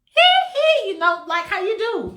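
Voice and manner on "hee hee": high, loud [name] squeal; [name] hee hee. Retold or imitated. Showing [name] his trademark squeal, high and loud. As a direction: yelp it [name]-style. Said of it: "do your [name] stuff".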